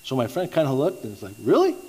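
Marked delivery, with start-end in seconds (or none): 1.4-1.8 high, loud mock-surprised "really?"; retold astonishment, pitch leaping up; shocked disbelief. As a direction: imitate a friend caught off guard. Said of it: "why are you so shocked".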